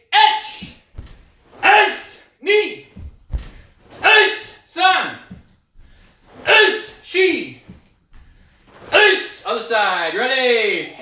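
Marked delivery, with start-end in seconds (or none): 0.1-9.4 sharp, high shouted counting; kiai count shouts. Real, not acted. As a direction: bark each count out with power. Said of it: "I try to get louder".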